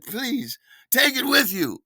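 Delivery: grand offer. Retold or imitated. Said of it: "I said".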